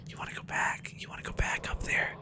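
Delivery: hushed whisper to a companion. Real, not acted. Whispering to a game companion in a dark house. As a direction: whisper it so nothing hears.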